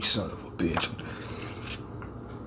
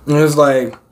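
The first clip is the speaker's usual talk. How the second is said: ordinary talk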